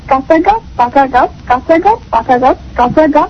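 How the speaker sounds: slow, careful twister; plodding through কাঁচা গাব পাকা গাব; deliberate tongue twister attempt; too slow. Shown as a demonstration. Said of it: "এত ধীরে না".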